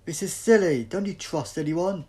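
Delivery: loud, high frustrated outburst; loud exasperation at distrust; exasperated shout. Acted. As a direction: throw up your hands and yell it. Doctor exasperated at the group.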